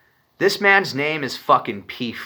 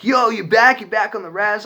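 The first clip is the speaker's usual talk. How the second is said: much higher, louder stoked greeting with a laugh; excited friends; 11 semitones higher, 5 dB louder than usual. Retold or imitated. Friends stoked he is back on the res, much higher excited laughing greeting. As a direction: greet him like long-lost family, laughing.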